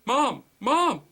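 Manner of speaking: high loud calls; desperate calls down a dead line; shouting Mom. Acted. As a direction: yell for her over static. Calling mother, no response.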